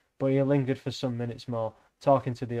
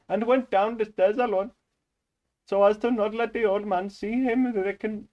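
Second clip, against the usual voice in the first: put-on Indian accent; 7 semitones higher than usual. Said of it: "you picked Indian for that reason".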